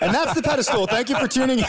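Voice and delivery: high mock sign-off while laughing; fake-close the show, laughing. Real, not acted.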